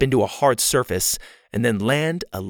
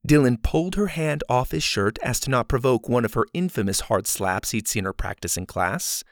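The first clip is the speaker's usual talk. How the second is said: usual speaking voice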